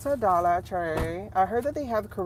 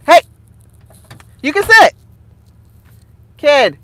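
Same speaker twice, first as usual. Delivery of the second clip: real parental shout; 6 semitones higher, 16 dB louder than usual. Real, not acted. Calling sharply to own child.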